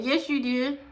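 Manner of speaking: usual speaking voice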